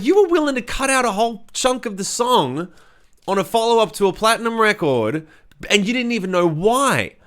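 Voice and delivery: high-pitched retold indignation, rising well above normal; indignant disbelief. Singer confronting the A and R guy over the cut, indignant. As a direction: throw the accusation back in high-pitched disbelief.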